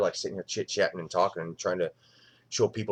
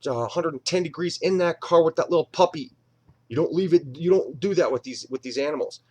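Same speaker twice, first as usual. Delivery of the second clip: firm scolding; 7 semitones higher, 4 dB louder than usual. Retold or imitated. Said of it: "she's like".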